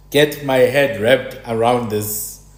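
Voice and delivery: normal talking voice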